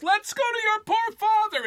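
high, loud panicked scream; frantic scream. A narrator's reading. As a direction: shriek in alarm. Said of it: "exchanged shout for shout".

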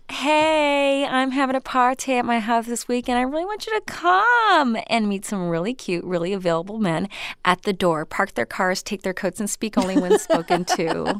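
high, chummy boss voice; fake-friendly female boss. Boss inviting her secretary to a party, high fake-friendly voice. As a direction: gush like the boss who plays your pal. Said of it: "a female boss who acts like they're your friend".

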